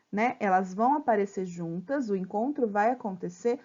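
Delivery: normal talking voice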